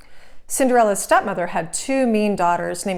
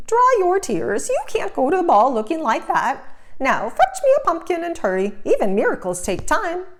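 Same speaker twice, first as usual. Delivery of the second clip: fairy godmother; 7 semitones higher than usual. Acted. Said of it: "I'm your fairy godmother".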